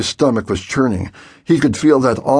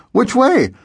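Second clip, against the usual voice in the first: much higher, urgent question; scared boy asking over the radio, much higher; anxious question; 13 semitones higher, 3 dB louder than usual. A narrator's reading. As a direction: ask in alarm over the radio.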